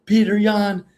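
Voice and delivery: high, projected promo voice; mock fighter callout. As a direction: cut a promo on your rival. Demonstrates a better fighter callout.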